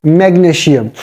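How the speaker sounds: "magnesium" with sh for the s; "magnesium" said like "magneshium"; mispronounced magnesium. Shown as a demonstration. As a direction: say "magnesium" as mag-nee-shee-um. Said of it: "it is not magnesium".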